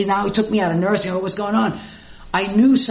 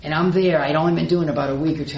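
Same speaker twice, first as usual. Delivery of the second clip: normal talking voice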